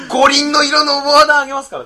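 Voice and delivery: high, loud begging pitch; hammed-up pleading offer. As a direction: beg loudly in a put-on voice. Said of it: "っつって".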